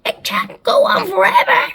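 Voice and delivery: high, loud character voice; yelled. A narrator's reading. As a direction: yell it in protest. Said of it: "Anne Spiker yelled".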